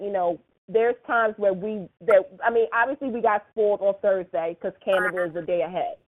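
ordinary delivery

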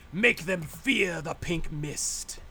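put-on slow, snooty British accent. Player imitating his game AI after a kill, snooty British accent. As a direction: savor each word in a put-on British accent. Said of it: "and the British, my, suddenly my voice".

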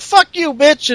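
high hammed-up snapback; mock Charlotte. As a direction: fire it back high and bratty. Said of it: "and Charlotte's like".

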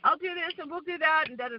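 high, sing-song mock of a smooth talker luring her over; coaxing Frederick. Woman mimicking Frederick inviting her to Sweden, high coaxing voice. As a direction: put on a sweet, wheedling sales voice. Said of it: "he's going".

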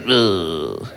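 low disgusted French "ugh"; hammed-up miserable groan; miserable French grunt. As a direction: groan with Gallic annoyance. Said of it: "they're annoyed and miserable".

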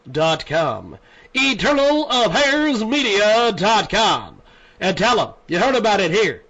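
projected announcer voice, phrase by phrase; sponsor ad read. Acted. Host plugging a new marketing partner to listeners, deliberate announcer read. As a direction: sell it with measured pauses and punched words.

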